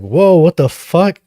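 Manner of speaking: retold shock. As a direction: act out being taken aback. Acting out his shock at cop.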